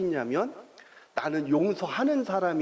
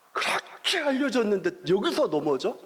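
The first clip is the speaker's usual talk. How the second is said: higher, exasperated reproach; irritated dad; 6 semitones higher than usual. Retold or imitated. Father scolding son who fell again, higher and irritated. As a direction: let the irritation rise in pitch. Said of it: "이게 화가 나는 겁니다".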